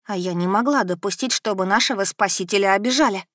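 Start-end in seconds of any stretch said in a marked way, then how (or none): none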